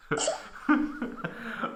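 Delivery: breathless unvoiced laugh; a disgusted airless laugh; silent wheeze laugh. Real, not acted. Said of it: "I almost threw up".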